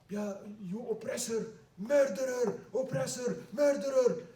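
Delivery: high, loud accusing cries; woman yelling. Acted. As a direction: hurl the insults at the sultan, high and loud. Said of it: "started yelling at Salah ad-Din".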